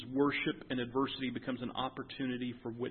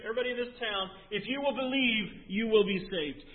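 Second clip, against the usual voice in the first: higher, louder preaching push; full-force preaching; 7 semitones higher, 6 dB louder than usual. Acted. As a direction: drive it home to the congregation.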